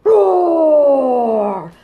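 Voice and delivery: loud growling roar; dinosaur roar. Acted.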